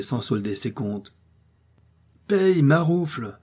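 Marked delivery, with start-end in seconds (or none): 2.3-3.4 slow, high, loud contemptuous order; traveller shouts. A narrator's reading. Traveller ordering his lackey to pay the innkeeper, contemptuous shout. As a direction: bark the order at the servant while galloping. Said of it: "s'écria le voyageur".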